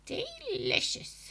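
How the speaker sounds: put-on very high, drawn-out old hag; witchy grandma voice. A narrator's reading. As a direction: savour the word like a witch. Grandma savoring bugs.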